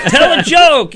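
high, loud heckling yell; mock heckler shout. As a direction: bark it out like a rowdy heckler. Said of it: "They're like".

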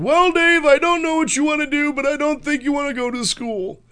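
dad giving up on his son, in a put-on high voice; Cecil Keckner impression. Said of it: "that's the best Cecil Keckner in the business".

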